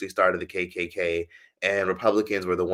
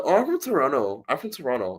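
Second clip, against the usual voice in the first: higher put-on Canadian lilt, easing off on the repeat; fake Canadian accent; 5 semitones higher than usual. Woman replaying her lie to the tourist, fake Canadian accent. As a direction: imitate a Canadian naming their hometown. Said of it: "That's how [name] talks".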